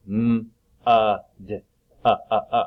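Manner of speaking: "M-, uh, d-" split with short uh sounds; segmented sounding out. Shown as a demonstration. Teacher sounds out mud for young kids. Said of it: "That's it".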